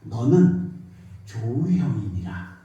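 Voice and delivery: 낮고 느린 하나님의 음성 흉내; slow, solemn divine voice, lightly done; voice of God. Retold or imitated. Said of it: "음성이 딱 들려오는데". (하나님 음성을 살짝 흉내 내듯 엄숙하게)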